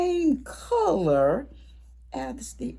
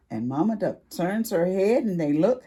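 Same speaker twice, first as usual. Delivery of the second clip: plain reading voice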